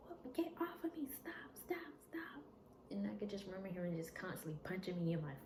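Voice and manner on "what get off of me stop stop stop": high-pitched reenacted protest; panicked pleading. She pleads while being attacked.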